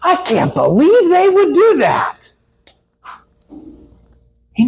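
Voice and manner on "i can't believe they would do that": high, mock shocked whine; hammed-up indignant surprise; shocked disbelief. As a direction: play it as a pretend flabbergasted gasp. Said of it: "surprised and shocked and".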